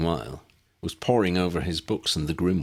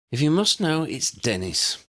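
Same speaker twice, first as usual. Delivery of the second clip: snapped; 10 semitones higher than usual. A narrator's reading. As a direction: bite the words off in irritation. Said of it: "she eventually snapped".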